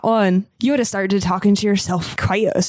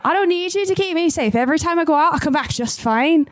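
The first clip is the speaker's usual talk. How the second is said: defiant raised shout; angry shout; shouting back; 6 semitones higher than usual. Acted. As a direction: yell back at him, stubborn. Shouted quarrel across the dock.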